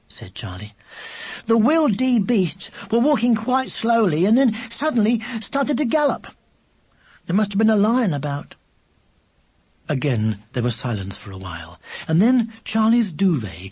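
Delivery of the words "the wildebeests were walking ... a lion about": put-on high-pitched child explaining himself; lightly indignant, high child voice; excuse-making little brother. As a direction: innocent little boy's excuse.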